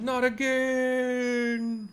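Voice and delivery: very high, slow, drawn-out howl of defeat; hammed-up defeated villain; villain's wail. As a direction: wail it out as the robot explodes. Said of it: "Sorry, that's probably better".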